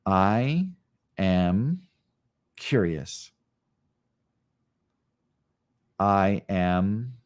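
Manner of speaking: slow affirmations with long pauses; guided meditation. Acted. Yoga teacher guides students through affirmations, unhurried meditative. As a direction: let each word settle in the silence.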